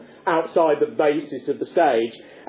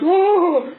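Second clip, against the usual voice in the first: high, loud swooning "oh"; hammed-up cry before collapsing; Judy's shocked cry; 12 semitones higher, 4 dB louder than usual. Judy sees the baby thrown, high mock shocked cry.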